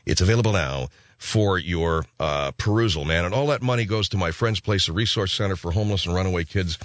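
ordinary talk

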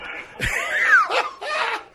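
high cackling laugh. Real, not acted. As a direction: burst out laughing. Host cracks up at own bit.

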